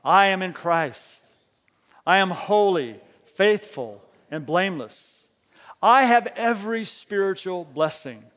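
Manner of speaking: loud, slow declaration; loud, slow congregational declaration; declaring together. Shown as a demonstration. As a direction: lead the room in a creed. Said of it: "Let's say these together".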